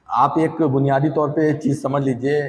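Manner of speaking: usual speaking voice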